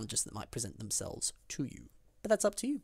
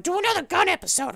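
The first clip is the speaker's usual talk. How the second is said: high, loud pleading fan voice; mock a fan begging for more; hammed-up fan request; imitating fans; 7 dB louder than usual. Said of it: "people are like".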